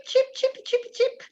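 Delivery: high chip chip like a little bird; playful birdsong; bird chirping. Shown as a demonstration. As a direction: chirp it lightly like a sparrow. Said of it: "In italiano è chip".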